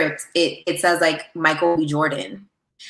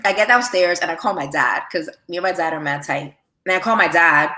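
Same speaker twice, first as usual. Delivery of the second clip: usual speaking voice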